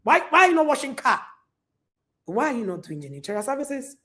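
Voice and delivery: usual speaking voice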